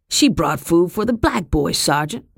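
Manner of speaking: a touch of a sulky grumble; complaining. Acted. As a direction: whine your case to the sergeant. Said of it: "he complained".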